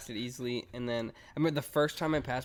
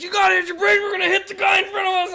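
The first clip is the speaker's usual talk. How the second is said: high, loud panicked shout; dad panicking as his son nears a crash; panicked dad yelling; 10 dB louder than usual. Retold or imitated. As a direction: yell in panic from the passenger seat. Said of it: "slams his hand on my chest".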